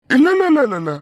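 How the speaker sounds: high, loud rapid-fire refusal; hasty denial. Acted. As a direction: cut in urgently, pitch shooting up. Hastily denies a mistaken match. (பதறி அவசரமாக மறுத்து)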